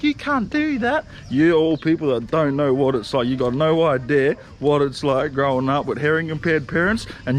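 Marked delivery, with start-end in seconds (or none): 0.0-1.0 high, slow sneering mock of a critic. Mocking the critics who say he can't, high and slow. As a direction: sneer it up high like a know-it-all. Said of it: "everyone that's like".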